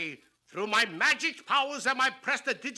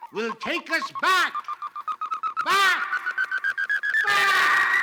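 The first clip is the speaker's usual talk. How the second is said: rising louder and higher; building echo; 8 semitones higher, 5 dB louder than usual. Acted. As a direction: swell each "back" bigger. Magic machine rewinding.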